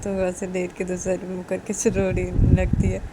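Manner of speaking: plain reading voice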